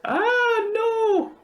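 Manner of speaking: high, hammed-up wail of no; fake-tragic plea not to lose her; mock anguish. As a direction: cry out in playful despair, pitch jumping way up. Streamer protests Nahida's sacrifice.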